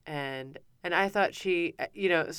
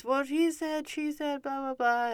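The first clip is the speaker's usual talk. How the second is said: much higher, mock kids all protesting; clamoring kids; 11 semitones higher than usual. Kids tattling to mom in the treehouse, mock high-pitched clamor. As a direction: imitate children piling in with complaints. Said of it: "they all started, you know, instantly at once".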